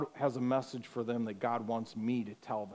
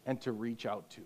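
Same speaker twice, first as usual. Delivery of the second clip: ordinary talk, nothing marked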